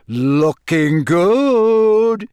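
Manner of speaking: long, drawn-out high, loud cheer; loud, elated holler; yelled cheer. A narrator's reading. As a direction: call it out over the crowd, stretching the last word. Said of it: "Ron yelled".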